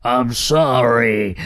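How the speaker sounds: slow, cowed dragon voice; spluttering. A narrator's reading. Dragon backing away from the beast, spluttering. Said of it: "he spluttered".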